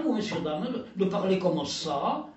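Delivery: usual speaking voice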